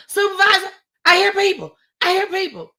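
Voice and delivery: retold frantic crying call, high and loud. Trapped woman to her supervisor on the phone, frantic crying. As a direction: call out high, on the edge of sobbing. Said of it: "I'm still boohoo crying".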